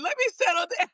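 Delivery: laughing through the words. Real, not acted. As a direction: laugh while you say it. Laughing while speaking.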